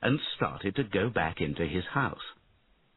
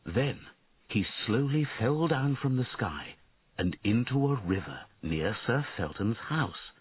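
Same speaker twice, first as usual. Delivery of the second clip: ordinary delivery, nothing marked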